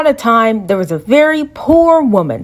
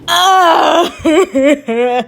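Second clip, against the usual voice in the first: crying laugh at the wedding; sob-laugh; 4 semitones higher than usual. Acted. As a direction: wail half laughing.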